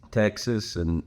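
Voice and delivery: ordinary talk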